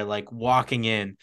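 ordinary delivery

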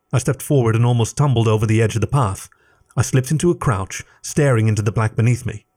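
ordinary delivery

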